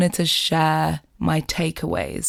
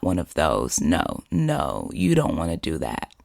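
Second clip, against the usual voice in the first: deep friend voice; 12 semitones lower, 4 dB quieter than usual. Retold or imitated. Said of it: "he said".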